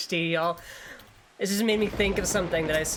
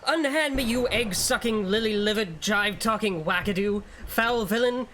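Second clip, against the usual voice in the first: mock-grand vampire tirade; indignant slang-mixing vampire; 4 semitones higher than usual. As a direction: hurl insults like a hammy old vampire. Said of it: "Vampires who mix all slang from the past five centuries".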